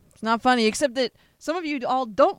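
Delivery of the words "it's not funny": louder, straight-faced correction; mean it this time; firm. Real, not acted.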